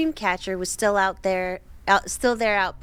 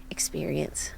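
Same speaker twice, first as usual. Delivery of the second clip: whispering; 7 semitones lower, 8 dB quieter than usual. Acted. Creepy reading, trailing whisper.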